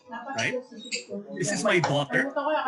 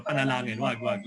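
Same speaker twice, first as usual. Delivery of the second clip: normal talking voice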